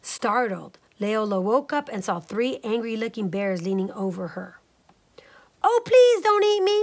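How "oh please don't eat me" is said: very high, loud pleading; panicked storybook squeal; frightened cry. A narrator's reading. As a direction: beg for your life as a scared little character. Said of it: "Leola cried".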